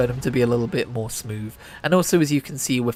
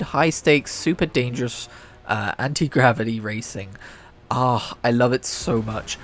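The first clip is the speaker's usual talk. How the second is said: ordinary talk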